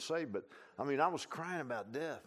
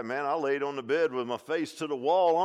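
ordinary talk, nothing marked

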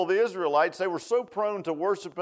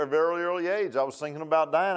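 ordinary delivery

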